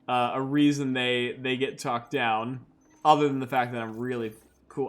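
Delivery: ordinary delivery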